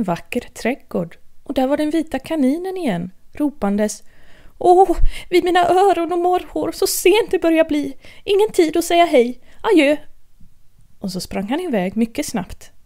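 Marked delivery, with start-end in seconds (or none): 4.6-10.0 high, hurried rabbit voice, flustered about the time; a rabbit panicking that he's late. Acted.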